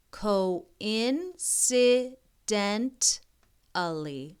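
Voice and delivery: very slow again, syllables apart; careful slow reading; sounding it out. Shown as a demonstration. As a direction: spell it with your voice. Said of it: "this is a big wor".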